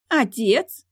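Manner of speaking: put-on high, loud girlish protest, rising at the end; spoiled daughter's indignant cry. A narrator's reading. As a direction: exclaim in outrage at your father. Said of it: "возмутилась вместе с ним девушка".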